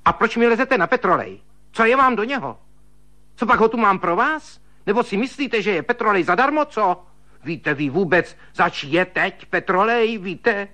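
put-on high, angry old maid berating him; shrill scolding. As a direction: rattle off a furious telling-off. Sister-in-law scolding over petroleum.

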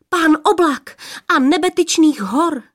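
high, excited outburst; breathless blurting. A narrator's reading. Third girl adding to father's titles, excited blurt. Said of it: "třetí vyhrkla, až se jí zajík hlas".

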